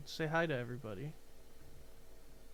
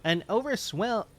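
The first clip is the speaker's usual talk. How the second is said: loud, raised, urgent dialogue read; dramatic game announcement; 5 semitones higher, 9 dB louder than usual. Acted. Streamer reads the mega power alert aloud, dramatic announcement. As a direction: proclaim the alert at full voice.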